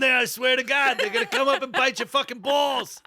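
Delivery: high, hammed-up Jersey Guido alarm. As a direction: warn frantically in cartoon Jersey. Jersey Guido warning of sewer gators.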